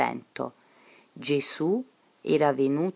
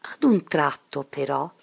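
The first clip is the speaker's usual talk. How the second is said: ordinary delivery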